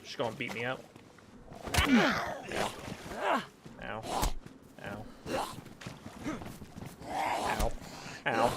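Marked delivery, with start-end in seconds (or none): 1.8-8.5 pained yelps. Acted. Being hit by zombies.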